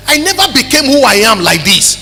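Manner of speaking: loud, high preacher's testimony building to a shout; full-force preaching. Acted.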